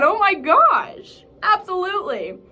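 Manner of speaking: high, loud, drawn-out delight; gushing excitement. Retold or imitated. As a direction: squeal a thrilled yes, playacted. Retells thrilled yes to church request.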